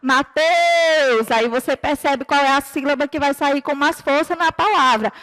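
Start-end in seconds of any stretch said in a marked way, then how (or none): 0.0-1.3 put-on mum calling a child in from another room, final syllable drawn out. Shown as a demonstration. Mum calling kids at home, calling out. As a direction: stretch the stressed syllable like a call. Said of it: "Como se fosse a mamãe chamando vocês em casa".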